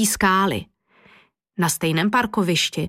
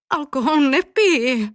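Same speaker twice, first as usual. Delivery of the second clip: prim refusal; 5 semitones higher than usual. A narrator's reading. Teacher declines offered rum.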